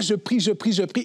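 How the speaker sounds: normal talking voice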